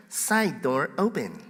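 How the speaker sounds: slow, soft automated announcement; be the alarm system's voice, slowly and softly; Telus alarm lady. Retold or imitated. Pastor imitating the office alarm lady, slow robotic announcement. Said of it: "the Telus lady says".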